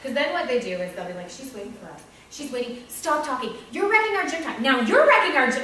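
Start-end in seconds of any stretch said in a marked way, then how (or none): 1.3-5.6 put-on escalating kids' nagging. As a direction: act out children policing each other, louder each time. Teacher acting out kids' escalating complaints.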